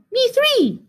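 very high little mouse squeak; teeny high-pitched mouse voice; tiny mouse. A narrator's reading. Mouse joins the toast.

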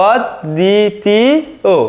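অদ দি তি ও, each syllable said apart. Shown as a demonstration. Teacher giving students the correct answer, syllable by syllable. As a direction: split the word into অদ, দি, তি, ও with pauses. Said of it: "তাহলে আমাদের সঠিক উত্তর হবে".

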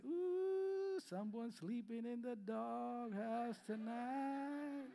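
high ooh, then slow singsong taunt; teasing son. Retold or imitated. Son mocking dad sent to the doghouse, slow singsong taunt. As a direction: taunt your dad slowly like a cheeky kid. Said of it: "But Caleb would say".

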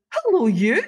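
high, loud gushing hello; effusive hello. Retold or imitated. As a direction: greet her like a long-lost friend. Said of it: "it was so effusive".

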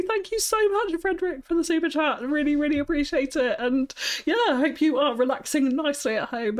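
bright high thank-you voice to a viewer; high-pitched voice. Real, not acted. Streamer thanking a donor, high-pitched voice. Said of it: "relaxing in my high-pitched voice".

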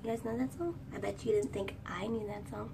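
ordinary talk, nothing marked

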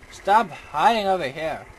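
loud, slow, stretched-out order; fed-up shout at enemies; exasperated scolding. Real, not acted. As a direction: scold them loudly, dragging the words.

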